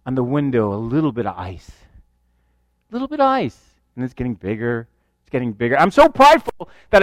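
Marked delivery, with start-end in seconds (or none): 0.1-1.7 much lower and softer; hushed suspense. Retold or imitated. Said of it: "I start to see". 2.9-3.6 higher, stressed repetition of little bit of ice; raised repeat. Retold or imitated. 4.0-5.8 much lower and softer; whisper-like creeping fear; hushed dread. Retold or imitated. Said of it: "growing fear on plane".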